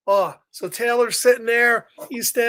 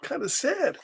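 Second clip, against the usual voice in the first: very soft, fast sad murmur; sad mumble; 7 dB quieter, faster than usual. Acted. Reader voicing sad Taylor refusing to talk. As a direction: shrink away, barely audible. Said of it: "Taylor was just".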